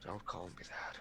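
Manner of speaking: very soft, shaken reply; withdrawn, near-whispered protest; hushed objection. Acted. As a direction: barely say it aloud. Shaken character answers friend in roleplay.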